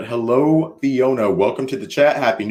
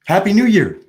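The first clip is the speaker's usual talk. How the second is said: cheery call; 7 semitones higher, 4 dB louder than usual. Real, not acted. Calling out New Year greeting.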